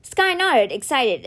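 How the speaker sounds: ordinary delivery, nothing marked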